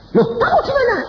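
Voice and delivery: very high, loud question; acted alarmed demand. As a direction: cry out the question as the startled wife. Acts out wife's alarmed phone question. (seruan istri yang kaget dan melengking)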